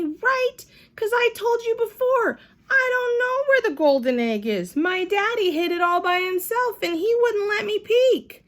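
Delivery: high, loud worried pleading; anxious protest. A narrator's reading. Lucille pleading with Sheldon, high and worried.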